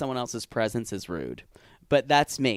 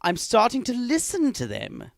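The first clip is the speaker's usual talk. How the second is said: higher, awed Grinch-like realization; mock Grinch voice; 6 semitones higher than usual. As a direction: play the Grinch discovering feelings. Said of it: "Grinch way of talking".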